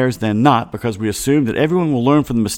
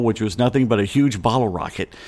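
ordinary talk, nothing marked

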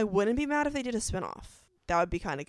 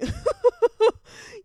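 big high burst of laughter; loud high laugh; 11 dB louder than usual. Real, not acted. Fan laughing about back-to-back tragic endings, loud and high. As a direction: laugh out loud at her own joke.

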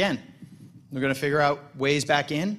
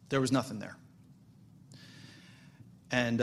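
ordinary delivery

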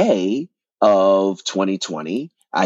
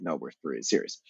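much softer and lower, matter-of-fact; low quiet reassurance; 7 semitones lower, 12 dB quieter, faster than usual. Retold or imitated. Bart reassuring James, low and hushed. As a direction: drop the voice low and calm. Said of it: "And he was like".

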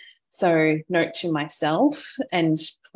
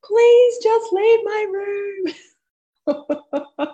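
rising to a high, loud, slowed yell; frazzled mother yelling at the kids to leave her room; escalating yell; 7 dB louder, slower than usual. Retold or imitated. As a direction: lose it at the kids, climbing higher and louder. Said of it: "It escalated very quickly".

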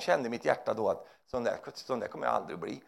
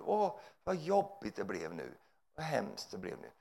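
softer, slow whiny sigh; mock lament; 5 semitones lower, 7 dB quieter than usual. Preacher imitating people surprised by troubles, soft lament. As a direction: moan it like a surprised victim. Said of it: "Så här".

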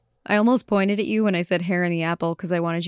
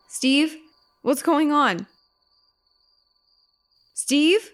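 anxious calling; 8 semitones higher, much slower than usual. Acted. Mary calls out to Steve.

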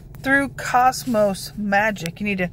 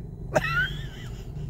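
giggle; 8 dB quieter than usual. Real, not acted. Laughs at her own facetious remark.

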